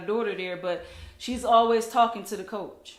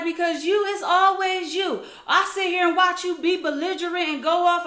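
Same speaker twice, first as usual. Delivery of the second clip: high, raised mock accusation; accusing imitation; 8 semitones higher, 4 dB louder than usual. Woman accuses the mother in the park, imitated accusing voice. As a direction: mimic a woman laying into someone.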